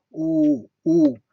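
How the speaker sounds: "Oh" loud and held, one syllable each; single-syllable demo. Shown as a demonstration. Teacher showing one syllable, "Oh" loud and sustained. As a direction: hold each "Oh" firmly on one mouth shape. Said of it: "Ici, on n'a qu'une seule syllabe".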